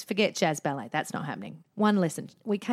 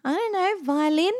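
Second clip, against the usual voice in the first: put-on little kid voice; 8 semitones higher than usual. Child voiced.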